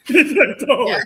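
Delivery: high, loud words through laughter; laughing. Real, not acted. Teller recalling the condition given to her, laughing. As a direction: laugh your way through the line.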